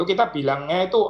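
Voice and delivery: usual speaking voice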